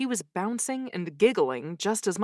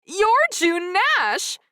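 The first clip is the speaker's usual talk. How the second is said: slow, high squealed recognition; 12 semitones higher, 5 dB louder, much slower than usual. Acted. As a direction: squeal it like a starstruck fan. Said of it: "her words morphed into a squeal".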